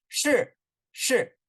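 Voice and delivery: 是 starting high and dropping sharply; falling tone. Shown as a demonstration. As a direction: say each 是 as a high, falling fourth tone. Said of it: "有数的down".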